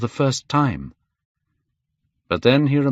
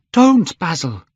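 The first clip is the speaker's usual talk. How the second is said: loud, high urgent plea; crying out; 9 semitones higher, 6 dB louder than usual. A narrator's reading. Dorian to painter holding a knife, crying out. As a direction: cry out to stop him. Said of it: "he cried".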